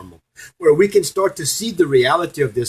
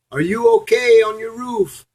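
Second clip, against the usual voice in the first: rescuer calling out; 11 semitones higher, 3 dB louder than usual. Retold or imitated. Boat rescuer calls to man.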